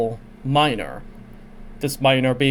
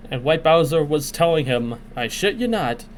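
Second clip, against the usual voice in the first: ordinary talk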